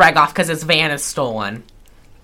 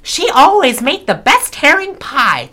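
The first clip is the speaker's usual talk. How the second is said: higher, lightly voiced Rose Nyland; sweet naive Saint Olaf storyteller; 8 semitones higher than usual.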